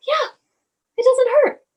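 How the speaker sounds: high, light little-boy voice, lightly imitated; son relieved. Mother voicing her son after the balm worked, high child voice. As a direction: mimic a small child saying the pain is gone. And he's like.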